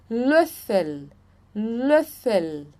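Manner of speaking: Löffel with a rounded ö, LÖF-fel; German pronunciation. Shown as a demonstration. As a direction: model Löffel with pursed lips. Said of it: "Vocês vão fazer um biquinho".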